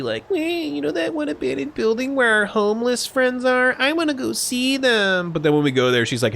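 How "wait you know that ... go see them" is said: put-on high, sweet child's voice; cutesy little girl. Mimicking Haruka to the audience, cutesy high little girl. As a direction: put on a cute kid voice, much higher. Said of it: "she makes it all cutesy".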